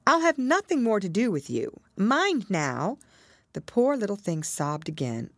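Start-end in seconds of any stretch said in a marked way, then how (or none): 0.0-3.0 high, firm ultimatum; girlish stern warning, raised in pitch; serious warning. A narrator's reading. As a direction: lay down the rule earnestly. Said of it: "said Alice seriously".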